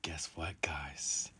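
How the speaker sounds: hushed teasing whisper; soft conspiratorial tease to viewers. Real, not acted. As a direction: tease the secret quietly. Teasing viewers before big news.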